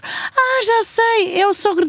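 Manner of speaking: high bright "aha"; light up as it clicks; sudden realization. Acted. Remembers who Jethro is.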